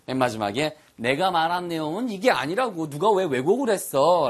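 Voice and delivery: ordinary talk, nothing marked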